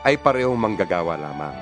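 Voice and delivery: ordinary delivery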